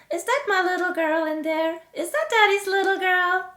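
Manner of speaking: high, loud singsong cooing; daddy's baby talk. Acted. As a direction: coo at your baby in singsong. Father cooing to baby in carriage.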